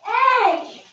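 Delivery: sharp loud protest; indignant shout. Real, not acted. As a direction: call out hey in protest. Sister takes the stool.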